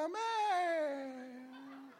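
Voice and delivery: mock tiny, weak amen with a laugh; lightly mock a timid amen; feeble little voice. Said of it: "con esa vocecita".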